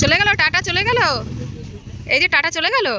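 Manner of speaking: high, loud excited call. Real, not acted. Calling to the others that Tata has gone, high excited shout. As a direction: shout it out, pitched right up.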